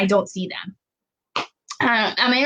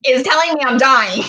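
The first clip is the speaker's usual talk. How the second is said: panicked; 5 semitones higher than usual. Retold or imitated. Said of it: "I'm like".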